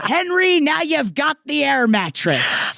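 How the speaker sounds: slow, high sarcastic congratulations to a freeloading relative; mock congratulation. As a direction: hand over the mattress with fake cheer. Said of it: "And I said".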